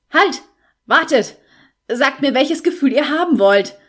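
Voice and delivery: loud, high panicked shout; bellowing. Acted. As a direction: yell after the retreating voice before it's gone. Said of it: "brüllte er".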